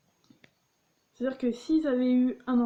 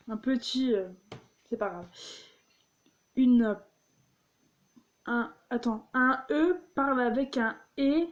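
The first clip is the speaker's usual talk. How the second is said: ordinary talk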